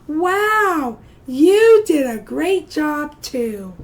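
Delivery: loud, delighted self-praise demo; excited praise. Shown as a demonstration. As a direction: model a proud wow for the kids. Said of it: "tell your neighbors or tell me".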